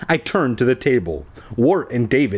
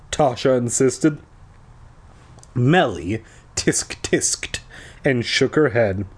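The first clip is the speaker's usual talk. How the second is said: ordinary delivery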